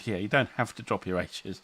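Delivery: normal talking voice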